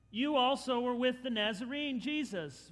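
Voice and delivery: put-on a girl calling him out; servant girl accusing. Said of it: "A servant girl says".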